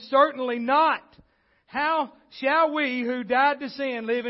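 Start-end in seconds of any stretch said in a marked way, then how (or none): 0.1-1.0 Certainly not, loud and falling hard; louder declaration; emphatic. Shown as a demonstration. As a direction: slam the brakes on Certainly not. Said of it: "with emphasis".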